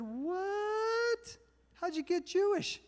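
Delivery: high squeaky stunned "what?"; shocked disbelief. Retold or imitated. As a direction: act utterly floored by the news.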